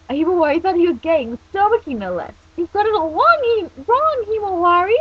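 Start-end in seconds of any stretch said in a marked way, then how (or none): 0.1-2.0 high, loud, quick gush; excited squeal. Acted. Reading a fan fiction girl's line aloud, excited squeal. 2.6-5.0 high, loud flustered protest; indignant cry. Acted. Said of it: "he cried, shaking his fist".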